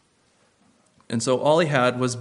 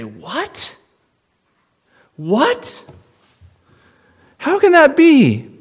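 rising, louder and louder in disbelief; shocked disbelief; 8 dB louder, much slower than usual. Pretend. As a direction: act out a stunned crowd crying out. Mock shocked listeners' reaction.

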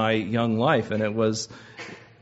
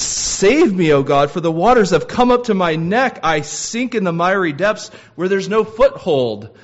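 higher and louder, a drowning man's cry; desperate plea; 6 semitones higher, 5 dB louder than usual. Acted. Preacher reading David's psalm to his congregation, desperate and loud. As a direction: cry out as the water rises. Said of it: "That's what distress feels like".

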